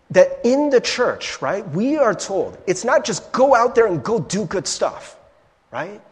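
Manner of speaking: ordinary talk, nothing marked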